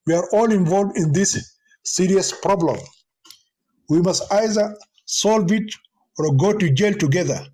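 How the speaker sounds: usual speaking voice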